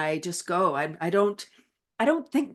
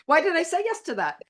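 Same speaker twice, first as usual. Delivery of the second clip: high, hammed-up dismay; mock panic; 10 semitones higher than usual. Guest mimics her regret after saying yes, mock dismay. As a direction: squeal at your own rash yes. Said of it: "And then I'm like".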